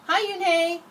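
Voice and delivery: bright, friendly high hello; cheerful greeting. A narrator's reading. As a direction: greet her like a regular. Shopkeeper greets her cheerfully.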